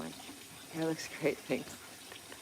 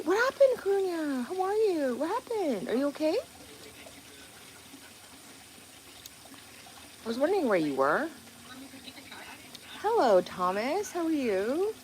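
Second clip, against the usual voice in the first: put-on baby talk; 10 semitones higher than usual. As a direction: coo it high at a pet friend. Greeting a pet, baby talk.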